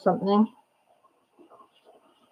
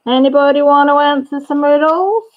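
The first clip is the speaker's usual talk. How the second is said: higher, lilting call with rising end; sing-song call; 5 semitones higher, 3 dB louder than usual. Real, not acted. Streamer inviting viewers to do riddles, sing-song call. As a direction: call out an invitation, last word stretched upward.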